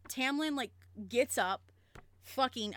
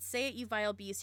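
plain reading voice

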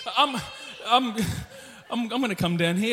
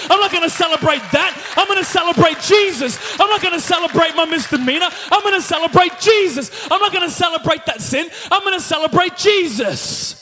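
rising preaching; 4 semitones higher, 5 dB louder than usual. Acted. Preaching the believer's declaration.

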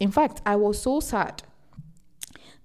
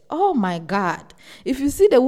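ordinary talk

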